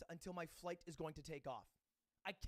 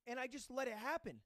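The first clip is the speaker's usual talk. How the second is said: normal talking voice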